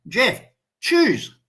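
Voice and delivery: high, pressing "choose"; insisting. Retold or imitated. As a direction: push him to pick a gun now. Uncle urging him to pick.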